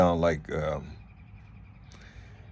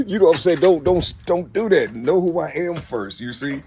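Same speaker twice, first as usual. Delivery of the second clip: higher and louder, worked up; excited rant; 9 semitones higher, 6 dB louder than usual. Real, not acted. Telling viewers off, worked up, higher and louder. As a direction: lay it down hard, all fired up. Heated rant at disrespectful people.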